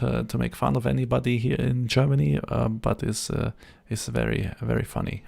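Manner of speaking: heavy German accent on English words. Shown as a demonstration. Streamer demoing his German accent to chat. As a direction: put on a thick German accent for fun. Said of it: "my German would sound like this".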